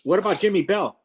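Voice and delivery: ordinary talk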